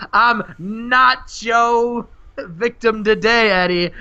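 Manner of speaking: high, drawn-out pun delivery; hammed-up wrestler's one-liner. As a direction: stretch the pun out like a punchline. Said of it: "and he says".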